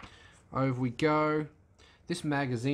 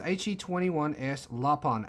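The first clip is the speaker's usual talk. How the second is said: ordinary delivery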